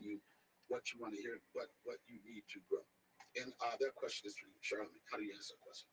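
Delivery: lightly hammed-up life-coach question to Charlamagne; mock deep question. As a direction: pose it like a serious interviewer, gravely. Reads a prepared deep question.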